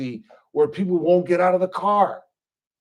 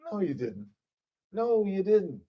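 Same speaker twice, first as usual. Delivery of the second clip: flat cop reply; 8 dB quieter than usual. Retold or imitated. Cop answers complaining arrestee.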